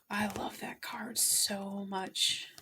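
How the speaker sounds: a slow, moved whisper; tearful whisper. Real, not acted. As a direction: whisper it slowly, deeply moved. Overcome by the card.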